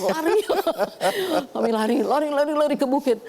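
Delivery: high, loud, laughing "run" calls; laughing retold cry. Doctor retelling the run uphill from the flood, laughing cries. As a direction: laugh through the urgent shouts to run.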